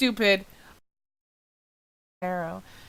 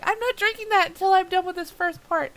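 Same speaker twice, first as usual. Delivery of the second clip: self-quote; 9 semitones higher than usual. Retold or imitated. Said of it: "I'm going".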